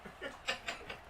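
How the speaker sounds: breathless silent laugh. Real, not acted. Laughing fit.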